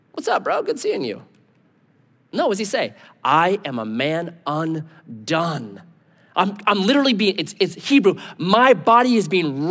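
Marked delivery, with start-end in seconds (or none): 0.1-1.2 high-pitched, breezy buddy voice; light, high mock-chummy hello; mock casual greeting. Joke answer, mock casual Isaiah. 3.2-5.8 very slow, weighty declaration; solemn, drawn-out confession; undone. Retold or imitated. Isaiah overwhelmed before God.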